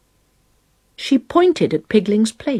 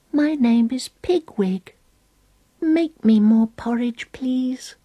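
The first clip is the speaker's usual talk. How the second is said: little voice; 6 semitones higher than usual. Acted. Little pig voice whispering at elbow.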